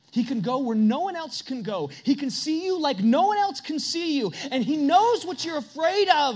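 swelling sermon climax; preaching at full force. Acted. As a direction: build the congregation to a peak.

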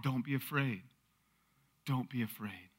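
gentle reassurance. Acted. Preacher echoes Jesus in the storm, reassuring. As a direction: say it hushed, as comfort.

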